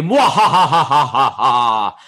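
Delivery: slow evil villain cackle; maniacal laugh. Retold or imitated. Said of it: "they all laugh maniacally".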